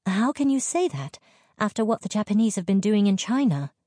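high, protesting outburst; high-pitched indignation; indignant exclamation. A narrator's reading. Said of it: "she exclaimed".

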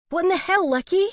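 high, loud Aussie disbelief; pacing and demanding answers; agitated outburst. A narrator's reading. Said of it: "Allie questioned as she paced".

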